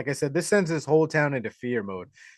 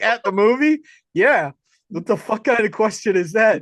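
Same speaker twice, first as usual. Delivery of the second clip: higher, louder, incredulous retort; mock incredulous guy; 6 semitones higher, 5 dB louder than usual. To the killer, a pitched-up scoffing retort. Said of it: "And he's like".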